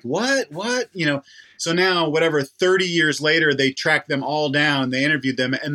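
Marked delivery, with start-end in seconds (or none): 0.0-0.9 high startled what; mock disbelief, pitched up; shocked teachers. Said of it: "their teachers were like".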